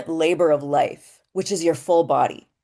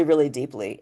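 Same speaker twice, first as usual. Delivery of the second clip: ordinary talk, nothing marked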